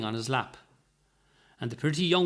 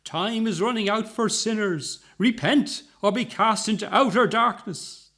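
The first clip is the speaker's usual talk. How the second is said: higher, declaiming preacher voice; sonorous tones; 6 semitones higher, 3 dB louder than usual. A narrator's reading. Tall woman with a cross proclaiming doom, in sonorous tones. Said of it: "announced in sonorous tones".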